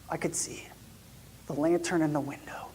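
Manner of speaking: hushed, breathy aside; whispering. Retold or imitated. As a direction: whisper it in awe. Sneaking up to the stable.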